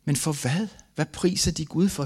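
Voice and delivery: retold inner protest, pitch lifted; anguished questioning. Bereaved father asking why they praise God, anguished questioning.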